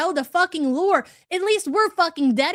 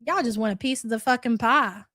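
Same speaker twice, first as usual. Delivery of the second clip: ordinary talk, nothing marked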